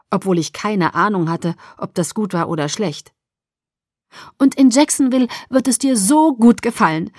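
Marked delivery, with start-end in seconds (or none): 4.4-7.1 higher, louder bubbly enthusiasm; gushing. A narrator's reading. Mother selling Jacksonville to daughter, gushing. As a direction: bubble over with delight. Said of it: "sprudelte sie weiter".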